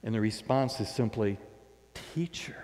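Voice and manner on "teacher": breathless whispered recognition. Retold or imitated. Mary whispering to the risen Jesus in awe.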